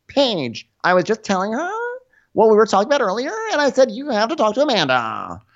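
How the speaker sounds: high, put-on explaining voice; hammed-up girlish self-defence; mock whiny girl. Friend explaining to Paige what she said, mock whine.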